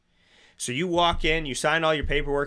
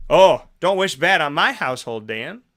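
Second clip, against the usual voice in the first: mock offended protest; 3 semitones higher, 7 dB louder than usual. Voicing an offended listener.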